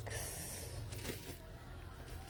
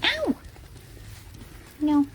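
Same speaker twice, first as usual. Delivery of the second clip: real yelp of pain; much slower than usual. Real, not acted. Bitten by a puppy's needle teeth, real high yelp.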